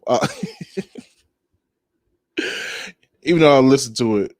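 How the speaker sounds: ordinary delivery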